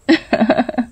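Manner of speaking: short high giggle. A narrator's reading. Rupa giggles at Raja's talk of the sadhu. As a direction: giggle teasingly.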